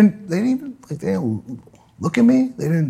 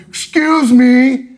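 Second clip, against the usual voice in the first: slow, higher, snooty excuse me; snooty cyclist; 8 semitones higher, 4 dB louder, much slower than usual. Retold or imitated. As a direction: drag it out like a snob. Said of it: "He goes".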